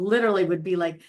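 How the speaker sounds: ordinary talk, nothing marked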